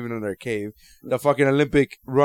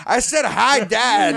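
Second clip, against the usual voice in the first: mock indignant yell; 6 semitones higher, much slower than usual. Daughter answering dad who hit her, mock indignant yell. Acts Meg's protest in retold scene.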